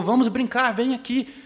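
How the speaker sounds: the glad tree begging him to play; eager tree. Acted. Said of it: "a árvore ficou toda contente de novo".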